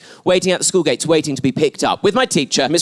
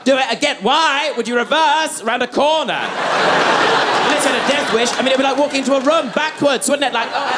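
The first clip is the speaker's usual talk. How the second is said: high-pitched comic exasperation; incredulous rant; 8 semitones higher than usual. Acted. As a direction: protest as if it were utterly absurd. Mock rant about reversing.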